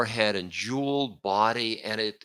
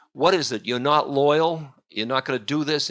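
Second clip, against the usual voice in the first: sharp, louder, higher scolding; upbraiding; 5 semitones higher, 5 dB louder than usual. Acted. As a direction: berate him for disloyalty. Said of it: "she upbraids him terribly".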